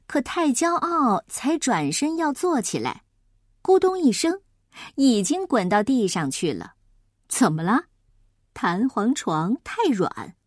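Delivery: ordinary delivery, nothing marked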